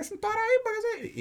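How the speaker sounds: high-pitched complaining whine; high mock whine. Young woman complaining to the supervisor, high mock whine. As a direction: squeal it out indignantly.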